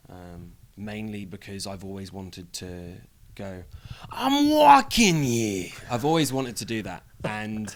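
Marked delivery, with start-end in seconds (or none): 4.1-5.7 high, drawn-out shout; New Yorker outburst. Acted. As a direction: yell it slowly at a passing cab. Acting out famous New York outburst.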